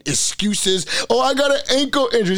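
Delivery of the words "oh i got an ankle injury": high, fast mocking whine; mocking whiny excuse. Mocking injured receiver's excuse.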